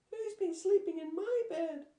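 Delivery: very high, loud sobbing cub; sobbing Baby Bear. A narrator's reading. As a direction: sob it out like a scared little bear. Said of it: "with a loud sob".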